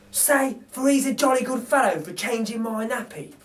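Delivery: lightly voiced thief teaching; coaxing burglar. Narrator voices Bill teaching the baby to talk.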